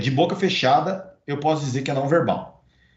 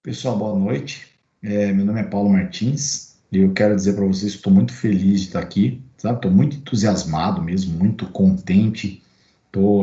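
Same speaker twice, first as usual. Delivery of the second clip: mismatched enthusiasm; 6 semitones lower than usual. Shown as a demonstration. Teacher introduces himself in a low, flat, unexcited voice. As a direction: sound bored while saying you're overjoyed. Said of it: "eu chego assim pra vocês".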